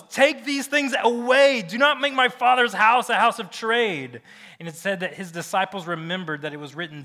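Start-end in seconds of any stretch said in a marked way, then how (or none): 0.0-4.2 loud, high, drawn-out command; angry Jesus. Acted. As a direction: thunder it like an outraged Jesus driving out traders. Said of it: "and he told those".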